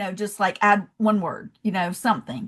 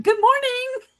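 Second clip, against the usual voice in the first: sweet Southern hello, pitched way up; chirpy greeting; slower than usual. Retold or imitated. Tourist greets New York cafe staff, cheery high sing-song. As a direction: sing out a bright good morning, much higher. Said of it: "every day I was like".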